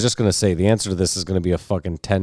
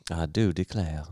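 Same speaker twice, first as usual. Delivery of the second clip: put-on soft, understated southern belle accent; a hint of Southern belle; 8 dB quieter than usual. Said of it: "like a Southern belle".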